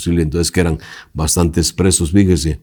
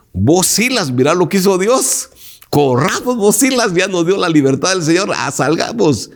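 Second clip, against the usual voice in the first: higher, excited call to a friend; eager urging; 10 semitones higher than usual. Pretend. Preacher imagines telling Silas to flee, excited and high. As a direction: urge Silas to run, thrilled.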